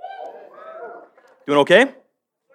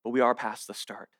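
low, soft, with long pauses; hushed preaching; 8 semitones lower, 10 dB quieter than usual. Real, not acted. Preacher on matters of the soul, hushed and solemn. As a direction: drop right down and let each phrase sit.

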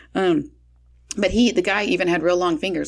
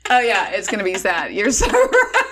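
laughing; 2 semitones higher, 3 dB louder than usual. Retold or imitated. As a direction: giggle at your own silly mistake. Laughing at her own blunder.

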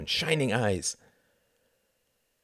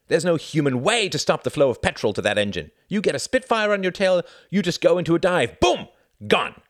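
high, loud, emphatic telling; excited boasting; 8 semitones higher, 5 dB louder than usual. A narrator's reading. Pilot showing how to lose a Spitfire, excited. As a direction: build with gusto to the boom. Pilot enthusing about a dive escape.

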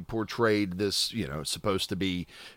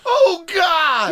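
shouting; 8 dB louder than usual. Retold or imitated. Movie quote shouted.

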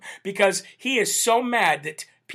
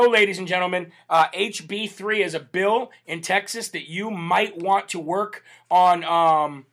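usual speaking voice

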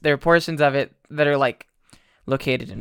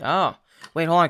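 usual speaking voice

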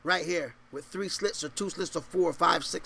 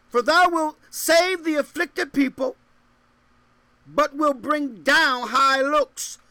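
raised, ringing verse reading; scripture proclamation; 7 semitones higher, 6 dB louder than usual. Real, not acted. Preacher reads scripture to congregation, proclaiming it. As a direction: proclaim the verse high and strong.

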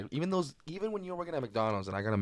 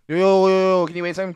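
loud, higher hurried call; 8 semitones higher, 11 dB louder than usual. Pretend. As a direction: call them back urgently. Customer calling back.

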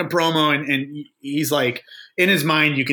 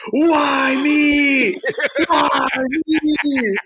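Nancy Kerrigan wail; 11 semitones higher, much slower than usual. Retold or imitated. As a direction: cry out like the sobbing skater clutching her knee. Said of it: "He pulls a Nancy Kerrigan".

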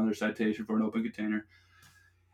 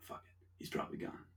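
whispered relief; 18 dB quieter than usual. Retold or imitated. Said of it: "I'm like".

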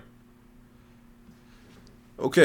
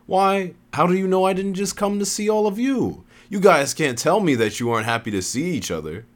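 Genesis; 10 semitones higher, 3 dB louder than usual. A narrator's reading. Newcomer going to hug an old acquaintance, coy. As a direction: play innocent, coyly. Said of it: "Genesis said coyly".

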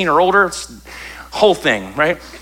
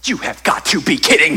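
exasperated groan; 3 semitones higher than usual. Shown as a demonstration. Pastor showing how grown-ups groan, exasperated. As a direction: groan it like a fed-up adult. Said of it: "Our moans and groans sound more like this".

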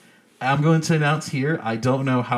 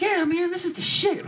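high-pitched little Tommy voice; little kid fan. Acted. Child fan approving the new album, high put-on voice. Voicing a little fan's reaction.

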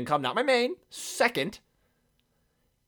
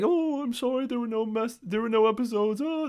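higher, whiny fake-sorry voice; mock apology; 7 semitones higher than usual. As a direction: parody a grovelling apology. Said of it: "This isn't me saying".

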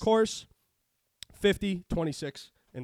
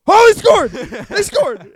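screaming with joy, much higher and louder; ecstatic yell; 12 semitones higher, 17 dB louder, slower than usual. Retold or imitated. Retelling the late touchdown to his co-host, an ecstatic scream. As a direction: yell it like your team just scored.

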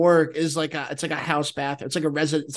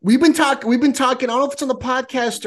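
excited; 7 semitones higher, 4 dB louder than usual. Real, not acted. Sudden excited start.

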